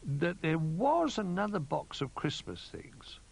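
high, drawn-out recollection; slowly. A narrator's reading. Old Bear recalling another box, slowly. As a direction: piece the memory together slowly. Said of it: "he said slowly".

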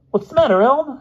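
high, loud eager question; bursting curiosity. A narrator's reading. Boy quizzing his dejected roommate, high eager curiosity. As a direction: pounce on it, bursting to know. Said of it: "bursting with curiosity".

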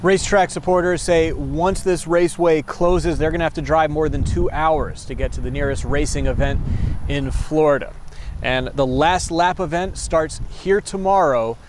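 loud live sign-off on location; field reporter. Acted. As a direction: project it like a TV reporter.

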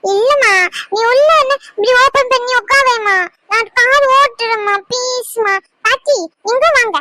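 put-on a little boy begging his mother; whining child. Child begs mother for toy car. (அம்மாவிடம் கெஞ்சும் குழந்தை)

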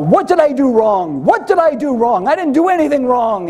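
high-pitched acted protest; crying out. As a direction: plead your innocence, pitch climbing. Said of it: "he's crying out".

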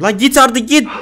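angry shouting. Real, not acted. Yelling at monster to leave.

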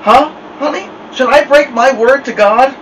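high, coaxing question; pressing her daughter sweetly; coaxing mother. Acted.

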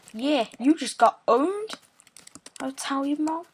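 ordinary talk

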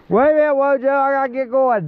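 high, urgent call to hold on. Real, not acted. Calls out to riding companion.

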